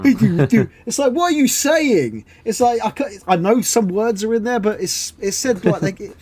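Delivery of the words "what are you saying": high, drawn-out incredulous question; slow comic outburst of disbelief; exasperated bafflement. Acted. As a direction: demand sense from a babbling gadget. Acted baffled reply to garbled speaker.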